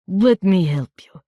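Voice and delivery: put-on slow rough country accent; a rough peasant offering help; coarse country accent. A narrator's reading. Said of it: "in a coarse country accent".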